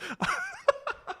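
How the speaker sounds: high, soft wheezing giggle; real laugh. Real, not acted. Streamer amused by his own impression, real giggle. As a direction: crack up softly in a high pitch.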